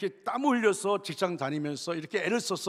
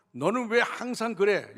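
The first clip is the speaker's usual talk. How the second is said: higher, louder, drawn-out reproach; mock scolding; 5 semitones higher, 5 dB louder, slower than usual. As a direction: imitate an exasperated parent telling off a child. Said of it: "자녀를 야단칠 때".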